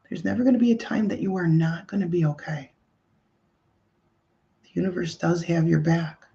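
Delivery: calm indoor voice leading breathing; guided meditation. Acted. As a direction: gently guide listeners to breathe into the heart. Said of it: "I'll turn on my indoor voice".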